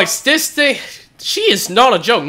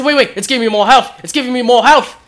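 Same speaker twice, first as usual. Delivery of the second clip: usual speaking voice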